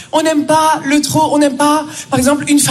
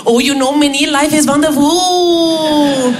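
put-on Indian-accented bliss, last word hugely drawn out; blissful Indian colleague; slower than usual. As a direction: gush contentedly as an Indian colleague. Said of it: "il allait trop bien".

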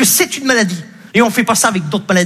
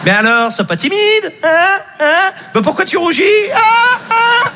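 put-on a baker teasing a blushing boy; 10 semitones higher, slower than usual. Boulangère qui moque l'enfant. (boulangère moqueuse, aiguë et forte)

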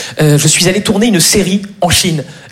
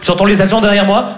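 normal talking voice

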